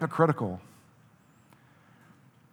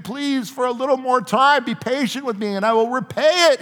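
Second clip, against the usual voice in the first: higher, urgent pleading; 8 semitones higher, 5 dB louder than usual. A narrator's reading. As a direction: beg him, voice raised and pressing. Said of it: "he pleaded".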